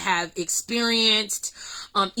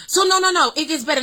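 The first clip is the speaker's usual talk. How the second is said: excited; 5 semitones higher, much faster than usual. Real, not acted. Building excitement.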